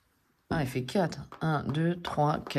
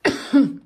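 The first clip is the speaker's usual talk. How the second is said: loud choking cough; 7 semitones higher, 9 dB louder than usual. Real, not acted. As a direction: hack out a cough. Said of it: "je m'étouffe". (grosse toux d'étouffement)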